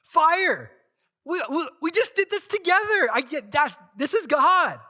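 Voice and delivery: high, loud, tumbling exclamation; excited shout. Retold or imitated. Imagined Elijah sees the fire, shouting excitedly. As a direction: shout it like you just saw fire fall. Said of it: "I imagine Elijah, if I'm like him, he's like".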